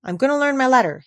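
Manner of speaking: high, loud panicked pleading; frightened boy calling her back; stammering with fear. A narrator's reading. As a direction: blurt it out in a fright. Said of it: "he said, stammering with fear".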